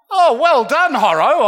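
sarcastic self-praise. Retold or imitated. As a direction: pat yourself on the back with sarcasm. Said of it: "I said to myself".

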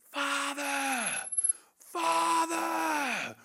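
long, high, drawn-out cry of Father; yelling. Retold or imitated. Preacher imitates the singer at the microphone, yelling. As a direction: imitate a singer's sustained high yell into the mic. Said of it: "He's just yelling".

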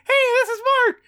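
high squeaky voice warming up; mock high warm-up. Mimicking Mark warming up his voice, high squeak. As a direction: start the name way up high, mocking. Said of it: "You're like".

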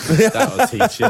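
laughing. Acted. Gleeful joke about dead child.

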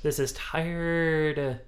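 sassy put-on voice, drawn out slowly at the end; mock dismissive. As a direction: brush him off like a jaded fan. Jaded fans dismissing Moore.

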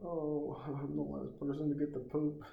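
put-on high, laughing girly voice; curly-haired girl whining about her place in line. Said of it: "She's like".

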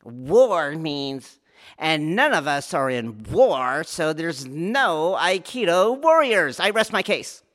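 pompous mock verdict, slowing on no Aikido warriors; mock jargonist. Teacher parodying a jargonist proving there are no warriors, smug. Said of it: "The jargonist's gonna go".

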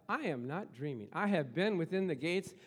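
D L Moody insisting loudly on his triumph; triumphant reply. Retold or imitated. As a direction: rise in triumph to glory. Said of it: "Moody replied".

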